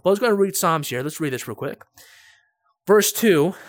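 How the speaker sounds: ordinary talk, nothing marked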